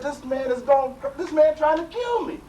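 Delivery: high, loud panicked cry; a man fighting for his life, frantic; mock panic of the half-drowned seeker. Drowning man's panicked thoughts.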